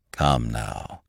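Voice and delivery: low, soft calming words; soothing. A narrator's reading. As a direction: soothe him, dropping low and quiet. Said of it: "said Mister Gowler soothingly".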